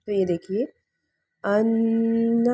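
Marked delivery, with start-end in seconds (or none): 1.4-2.4 ان with the nasal held long; ان drawn out with ghunna. Shown as a demonstration. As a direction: hum ان through the nose. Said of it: "جس طرح سے ہم پڑھتے ہیں".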